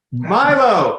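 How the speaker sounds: loud high call; yelling across the room. Real, not acted. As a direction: call the dog from another room. Calling dog from upstairs.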